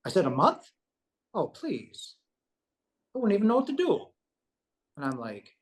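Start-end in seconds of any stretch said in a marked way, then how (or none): 0.3-4.1 amazed disbelief. Retold or imitated. Reacting to monthly pay offer, amazed. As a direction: pitch leaping high on the reaction.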